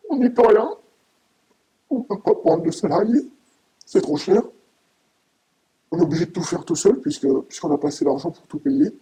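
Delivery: emotional outburst, rising in anger; upset and angry. Real, not acted. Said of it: "Vous avez l'air très ému, mais aussi très en colère".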